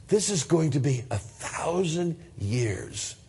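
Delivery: ordinary talk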